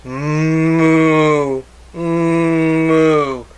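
mock cow voice; cow moo. Imitating cow.

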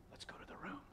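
put-on hushed kid's whisper, voice dropped right down; conspiratorial whisper. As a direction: whisper like a child plotting mischief. Kid plotting, quoted in whisper.

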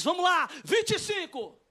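high, slow mock auction call; acted rival bidder calling out; imitated host bid. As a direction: announce the bid to the hall.

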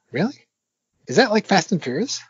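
high, teasing disbelief; mock surprise. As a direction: needle him as if the game is a copy. People mock-question his game.

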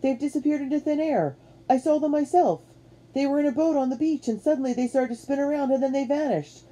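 high, insistent testimony; agitated wheezed report. A narrator's reading. Captain telling Washington the children vanished, agitated report. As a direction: blurt it out, desperate to be believed. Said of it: "the captain wheezed".

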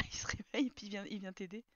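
hushed, unvoiced and quick; whisper. Real, not acted. Player whispering a joke about the sleeping husband, hushed. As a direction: breathe it out in a secret whisper.

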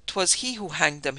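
ordinary delivery, nothing marked

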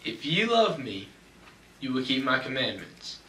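normal talking voice

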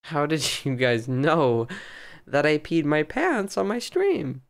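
slow, drawn-out stunned question; melodramatic disbelief. Acted. Streamer asking chat how they know, slow melodramatic disbelief. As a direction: drag out each word in mock horror.

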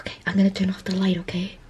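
whispering. Real, not acted. Hiding, whispering before lights out.

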